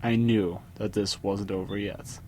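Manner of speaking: usual speaking voice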